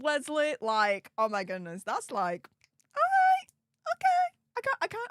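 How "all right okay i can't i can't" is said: high, loud, delighted squeal; a sudden burst of delight; excited squeal. Real, not acted. Squeals at a viewer's kind message.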